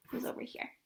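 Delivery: soft whisper on the side; whispered aside. Real, not acted. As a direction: lean in and whisper it like a secret. Narrator's aside showing the picture.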